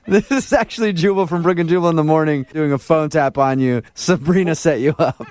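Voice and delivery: radio host laughing as he drops the act; laughing reveal. Real, not acted. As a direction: grin through the big reveal. Prank host reveals himself.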